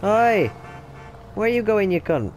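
loud yell after a car driving off. Real, not acted. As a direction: shout after the taxi that left. Yells at the departing taxi.

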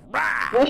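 loud high playful scare roar; mock monster roar. Clown wife leaping out at husband, playful roar.

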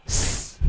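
a long hissed "S"; voiceless S sound. Shown as a demonstration. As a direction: sound out "S" alone, with no voicing. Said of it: "Âm thứ nhất đó là".

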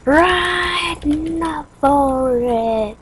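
very slow, high call; drawn-out urging. Pretend. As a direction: stretch each word out long. Urging game character on.

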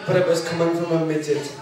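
usual speaking voice

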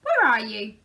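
loud call through the house. A narrator's reading. Mister Busy calling inside the house, loud. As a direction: call up to find him. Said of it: "he called".

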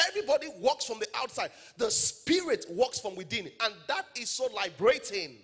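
loud, high, driving sermon delivery; full-force preaching. Real, not acted. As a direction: preach it hard to the whole congregation.

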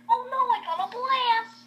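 high-pitched cartoonish cry; mock alarm. Missy about to blast off, high mock alarm. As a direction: exclaim it high, in playful dismay.

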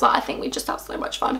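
breathy secret whisper; whispered aside. Real, not acted. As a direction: whisper it like a confession. Joking aside about wanting to hang out.

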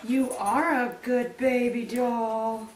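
slow, cooing compliment to the dog; slow pet praise. Real, not acted. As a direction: dote on the dog. Praising dog between tries.

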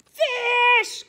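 very high, loud squeal; penguin chorus. Acted. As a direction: squeal it for the photo. Penguins saying cheese.

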